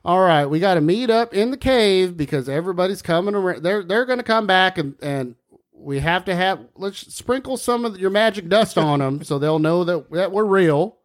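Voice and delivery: light country accent for a pretend bat; folksy bat accent. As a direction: chat like a down-home bat planning to meet up. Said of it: "That's a different turn of accent".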